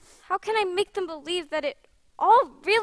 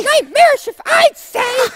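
loud, higher mocking cry; scornful shout; 8 semitones higher, 10 dB louder than usual. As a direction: jeer at him loudly.

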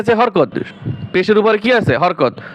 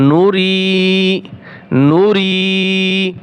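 Nuri with the রি drawn long; slow recitation model; slow model reading; 2 semitones higher, much slower than usual. Shown as a demonstration. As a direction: hold the রি, slowly. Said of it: "teacher models the word slowly".

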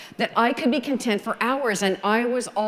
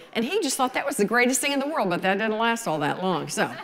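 usual speaking voice

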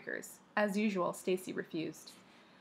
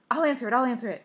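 mild shriek, raised and louder; excited, urgent repeated cry; 5 semitones higher, 7 dB louder, much faster than usual. A narrator's reading. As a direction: shriek it eagerly, held back a little. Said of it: "she shrieked".

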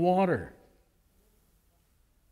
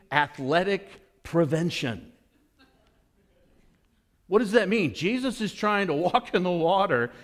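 ordinary talk

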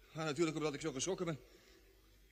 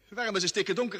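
raised, louder alarmed question into the dark; alarmed call; 5 semitones higher, 8 dB louder than usual. Acted. Jimmy calls out to Doc in the dark, alarmed. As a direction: sound the panic of waking up blind. Waking in total darkness, alarmed.

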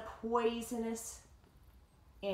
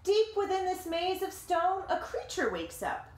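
lively read-aloud voice, higher than the chat; story narration; 8 semitones higher, 3 dB louder than usual. A narrator's reading. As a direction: tell it like a picture book to kids. Reading the picture book aloud.

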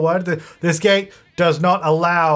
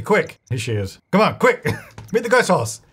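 usual speaking voice

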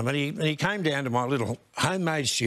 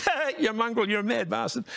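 higher mock Farnham voice; imitating Farnham; 9 semitones higher than usual. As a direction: laugh it out as the cheeky mate. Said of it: "And he goes".

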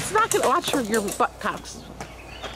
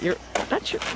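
whispering; 3 semitones higher, 4 dB quieter than usual. Real, not acted. Mom hushed over burning phone.

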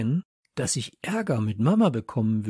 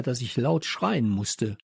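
usual speaking voice

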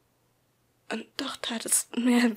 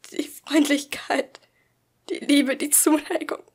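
higher, louder, pained; hurt outburst; 5 semitones higher, 8 dB louder than usual. A narrator's reading. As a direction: let the hurt break through.

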